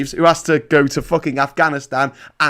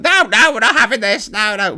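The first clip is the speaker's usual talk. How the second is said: mock politicians refusing; 9 semitones higher, 3 dB louder than usual. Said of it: "E R G and the D U P would have said".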